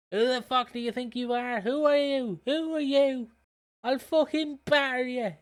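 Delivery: high-pitched mock yell in a Dublin accent; yelling Dublin woman. Shown as a demonstration. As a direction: imitate a Dublin woman having a go at another. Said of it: "do how Dublin women would, like, yell".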